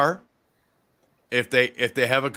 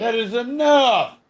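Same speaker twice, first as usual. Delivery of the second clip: slow, loud, higher stern scold; scolding the dog; 10 semitones higher, 5 dB louder, slower than usual. Real, not acted. Owner scolding his puppy rattling the door, slow loud reprimand. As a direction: bark it out slowly at the dog to stop.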